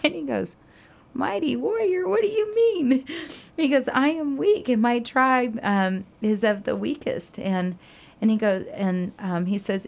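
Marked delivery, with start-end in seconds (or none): 1.1-3.1 high, whiny protest; whining Gideon. Retold or imitated. Gideon whining back at the angel in a high voice. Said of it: "here he's whining and complaining". 3.9-7.4 higher, complaining voice; whining Gideon. Retold or imitated. Gideon complaining to the angel about his weak tribe. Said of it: "here he's whining and complaining".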